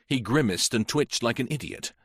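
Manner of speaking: ordinary delivery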